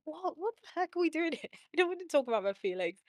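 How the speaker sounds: high, incredulous mock outrage; exasperated disbelief. Trainee therapist scoffing at talking about feelings, mock exasperated. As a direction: throw your hands up at the idea, laughing it off. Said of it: "And I thought".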